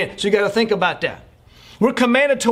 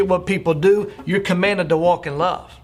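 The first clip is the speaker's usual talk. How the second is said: ordinary delivery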